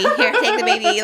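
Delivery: laughing mock offer. Joking about giving Rachel her baby, laughing. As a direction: hand over the baby through a laugh.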